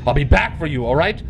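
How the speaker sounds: usual speaking voice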